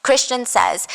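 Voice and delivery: usual speaking voice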